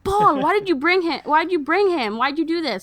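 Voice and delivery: high, loud mock outburst at Paul; acted-out scolding yell; imitated yelling. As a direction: yell accusing questions, high and easing off. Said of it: "Ganga yells at Paul".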